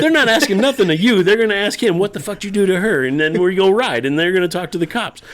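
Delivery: high, worked-up acted argument; heated explaining. Husband explains to angry wife his plan, high heated retelling.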